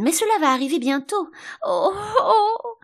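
high, loud lament ending in cries; anticipatory wailing. A narrator's reading. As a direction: wail as if the pain is coming. Queen wailing before she is hurt.

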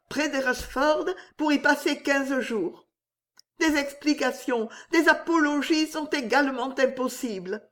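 high strained voice held steady; trying hard to sound firm; forced firmness. A narrator's reading. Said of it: "s'efforçant de parler avec fermeté".

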